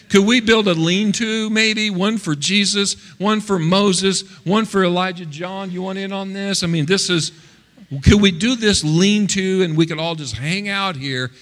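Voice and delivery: high, eager chatter, imitating Peter; impulsive Peter. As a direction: blurt it out like an over-keen disciple. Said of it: "opens his mouth before he thinks about what he's saying".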